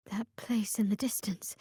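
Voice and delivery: hushed, wary whisper. Acted. Cleric spotting a distant place, in a whisper. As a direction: point it out without being overheard.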